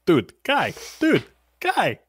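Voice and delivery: high chirpy dude guy; bird voice. Pretend. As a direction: squawk like a pet bird. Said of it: "Michael talking to Dimitri".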